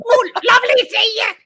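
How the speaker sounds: very high, loud, badly faked Aussie; worst Aussie accent. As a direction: shriek it in a hammed-up Aussie accent. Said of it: "This is the worst accent".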